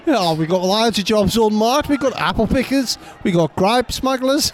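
lightly voiced Australian farmer hiring; Australian accent. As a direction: play the cheery Aussie boss. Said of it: "My Australian guy's".

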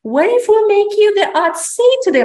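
high, loud playful push from the mentor; mentor's teasing challenge. Retold or imitated. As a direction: lift it up high, coaxing. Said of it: "And she's like".

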